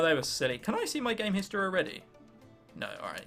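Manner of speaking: usual speaking voice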